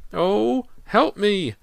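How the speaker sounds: very high, loud plea; desperate scream. A narrator's reading. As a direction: scream for help in terror. Sinking into tar.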